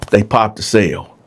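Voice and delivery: normal talking voice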